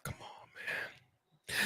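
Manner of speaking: slow whispered "come on, man"; whispered dismay. Real, not acted. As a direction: breathe out your disappointment. Dismayed after loss.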